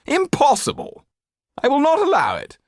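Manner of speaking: high, disdainful aristocrat voice; haughty Marquis. Acted. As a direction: sneer it down from on high.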